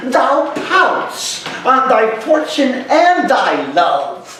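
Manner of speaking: slow, high-pitched theatrical Shakespearean declamation; impassioned stage friar, drawn-out phrases rising in pitch; stern Friar Lawrence. Acted. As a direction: scold a despairing young man, then counsel him, in measured stage verse. Acts Friar Lawrence's rebuke of Romeo.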